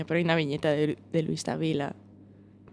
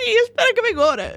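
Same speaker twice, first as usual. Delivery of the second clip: high, fast, breezy reply; hurried friend; 5 dB louder, much faster than usual. Retold or imitated. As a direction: toss it off quickly and cheerfully. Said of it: "Y me dice".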